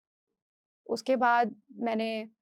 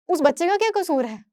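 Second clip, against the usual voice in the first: loud, high-pitched indignant question; retold pleading protest; 7 semitones higher, 8 dB louder than usual. Wife defending her child to husband, retold as loud protest. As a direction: protest with the voice lifted high and forceful.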